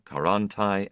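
usual speaking voice

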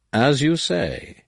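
slow, yielding agreement; easy concession. Acted. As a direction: give in lightly, unbothered. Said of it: "Nomanor conceded easily".

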